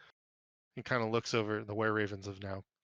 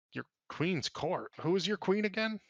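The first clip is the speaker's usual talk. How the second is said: surprised; 8 semitones higher than usual. Acted. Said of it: "looks at you in surprise and he goes".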